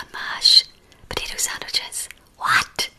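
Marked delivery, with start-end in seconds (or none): none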